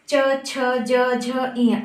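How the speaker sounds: च छ ज झ इय sounded one by one, tongue touching the teeth; pronunciation demo. Shown as a demonstration. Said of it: "हमारे दांतों को हमारी जीभ टच". (च वर्ग का साफ़ उच्चारण)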